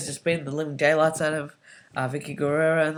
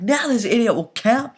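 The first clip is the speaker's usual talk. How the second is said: mock commentator yell, higher and louder; louder, higher snipe at the referee; commentator outburst; 6 semitones higher, 7 dB louder than usual. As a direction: bark it like an exasperated announcer. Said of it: "He's like".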